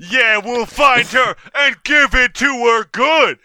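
high, slow, loud leering brag; crude loud boasting. Acted. Rowdy customer bragging loudly to friend. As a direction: boast it loud to your buddy.

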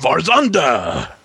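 dramatic announcer. Pretend. Grand announcement of the name.

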